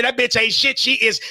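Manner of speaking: ordinary delivery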